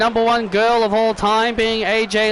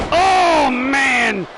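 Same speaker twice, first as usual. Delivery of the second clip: high exclamation falling away; excited reaction; 3 semitones higher than usual. Pretend. Commentator sees Nia crushing her opponent, excited exclamation.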